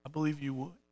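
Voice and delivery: hushed second take of the line; quiet echo. Retold or imitated. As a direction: say it again almost to yourself. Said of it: "He says".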